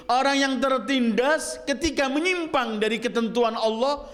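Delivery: high-pitched, insistent sermon voice; impassioned preaching. Real, not acted. Preacher warning the oppressed who stray from God, high insistent preaching.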